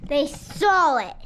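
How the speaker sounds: ordinary delivery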